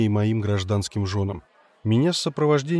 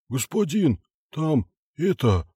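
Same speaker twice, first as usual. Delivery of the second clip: panicked; 4 semitones higher, slower than usual. Acted. Panicked foreman blurts out.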